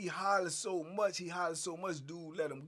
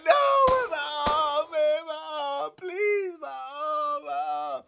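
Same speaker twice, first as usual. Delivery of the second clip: pleading in pain; 8 semitones higher, 9 dB louder, much slower than usual. Retold or imitated. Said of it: "He said".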